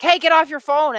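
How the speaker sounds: annoyed demand. Retold or imitated. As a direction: snap at partner to drop the phone, half-mock. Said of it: "and you're like".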